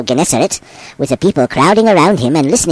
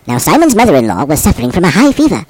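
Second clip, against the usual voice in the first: ordinary delivery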